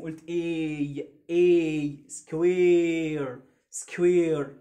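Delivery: إي drawn out very slowly and loud in سكوير; teacher stretching the e; stretched vowel. Shown as a demonstration. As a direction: hold the e long in سكوير. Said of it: "نطقت الإي باسمه".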